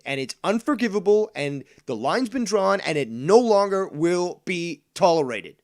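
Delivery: loud, high furious ranting; angry rant. Real, not acted. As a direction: yell it at the idiots. Ranting at trolls.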